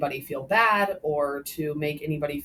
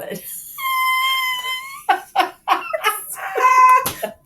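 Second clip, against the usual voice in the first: big burst of laughter; loud laugh; 7 dB louder than usual. Real, not acted. Laughs hard at own joke.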